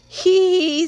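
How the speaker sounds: tittering hee hee. Acted. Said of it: "said Mister Raymond".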